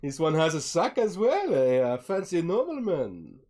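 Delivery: high, loud, swooping character voice; teasing Vistani trader. Acted. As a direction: play the trader mock-impressed by a customer. Vistani teases the customer.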